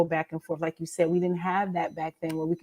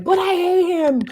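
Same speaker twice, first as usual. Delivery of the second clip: high, louder reader's whine; mock protest; 10 semitones higher, 6 dB louder than usual. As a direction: imitate an indignant fan. Imitates readers protesting a character.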